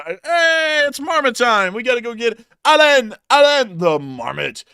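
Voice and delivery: very high, loud cartoon squeal; high loud cartoon rodent; squeaky marmot voice. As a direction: shriek like an excited little critter. Playing Alan the marmot segment.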